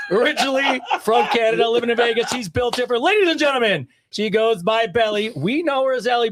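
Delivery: booming boxing ring introduction; ring announcer voice. Shown as a demonstration. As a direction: announce her like a fight night MC. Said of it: "ring announcer voice on here".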